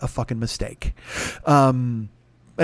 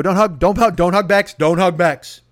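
raised, louder inner-voice panic; urgent self-warning; 3 semitones higher, 5 dB louder than usual. Acted. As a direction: repeat it like an insistent alarm to yourself. Frantic inner voice warns himself.

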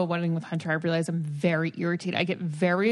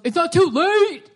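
loud, higher jeering heckle; drunk heckler; 10 semitones higher, 7 dB louder than usual. Retold or imitated. Drunk guest heckling as the bride walks down, loud mocking jeer.

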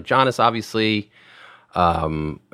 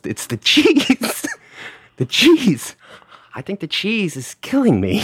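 put-on much higher, slow French accent with a laugh; 12 semitones higher, slower than usual. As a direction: play the suffering Frenchman, high and drawn out. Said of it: "in a beautiful French accent".

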